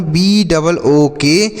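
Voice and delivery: letters spelled out slowly, one by one; slow classroom letter-by-letter dictation; slow spelling out. Shown as a demonstration. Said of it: "देखिए यहाँ पर लिखा गया".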